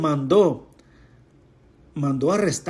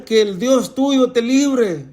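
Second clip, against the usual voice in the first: slow, higher call; king's cry; 6 semitones higher, slower than usual. Acted. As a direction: cry out slowly to Daniel. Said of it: "el rey le gritó a Daniel". (grito lento y agudo del rey)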